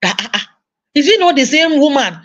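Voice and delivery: lightly voiced bewildered kid; confused child. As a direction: act out a child's baffled question. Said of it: "your child is confused".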